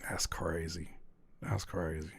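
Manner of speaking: very low and hushed. Acted. Reacting to friend's question, deep hushed voice. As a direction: drop to a deep near-whisper. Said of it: "that's why I'm using that voice".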